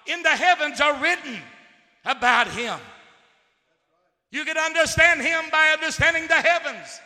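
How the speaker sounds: loud, ringing preacher's cry; sermon crescendo about the heavens; preaching at full force. Acted. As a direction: proclaim it to the back row. Preaching climax.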